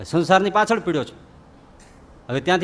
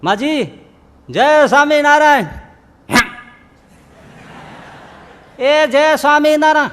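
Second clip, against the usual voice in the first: put-on high, loud greeting to a deaf old woman; calling out loud; 11 semitones higher, 8 dB louder than usual. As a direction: call across to her.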